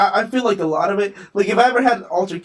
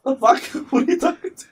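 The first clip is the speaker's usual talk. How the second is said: high, loud mock outrage with a laugh; laughing mock outrage at a friend's trash talk; incredulous retort; 9 semitones higher, 5 dB louder than usual. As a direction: scoff back in disbelief, laughing. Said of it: "and I'm like".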